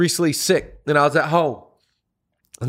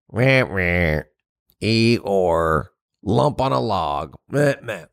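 low, droning mope; slow-witted, dreary donkey voice; Eeyore impression; 8 semitones lower, slower than usual. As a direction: drop it way down like a gloomy lump on a log. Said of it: "those are great sound effects".

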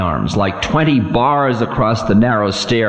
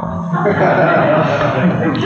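mocking laugh; 5 semitones higher than usual. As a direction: laugh at him with scorn. Demon mocks Time.

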